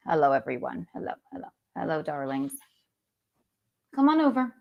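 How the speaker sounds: low, soft sultry host voice; breathy vintage purr. Acted. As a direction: purr the greeting like an old-time starlet. Sultry host voice greeting audience.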